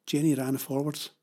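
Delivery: usual speaking voice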